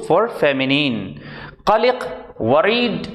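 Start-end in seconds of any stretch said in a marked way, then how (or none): none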